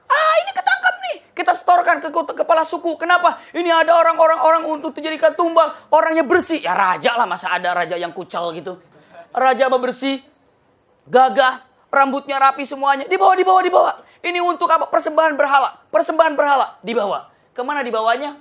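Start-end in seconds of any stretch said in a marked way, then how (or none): 0.1-6.6 very high, loud, gleeful tribesman; excited tribesman. Acted. Tribesmen catch the king. 13.1-16.8 high, loud chanting shout; shouting tribesmen. Acted. Tribesmen haul the captive.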